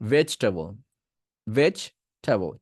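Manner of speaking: ভেজিটেবল as vech-te-bol, middle i cut; vegetable, three syllables. Shown as a demonstration. Teacher showing ভেজিটেবল in three syllables. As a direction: say ভেজিটেবল slowly, skip the e after j. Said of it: "এটা কেটে দিতে হবে".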